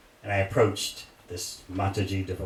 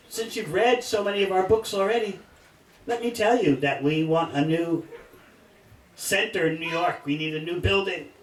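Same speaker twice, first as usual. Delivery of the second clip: lady making a pitch; 7 semitones higher than usual. Retold or imitated. Lady's pitch voiced by teller.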